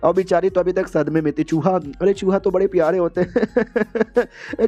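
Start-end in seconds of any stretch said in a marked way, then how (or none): 1.4-4.7 cooing over rats. Acted.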